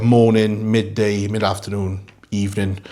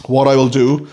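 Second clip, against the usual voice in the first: normal talking voice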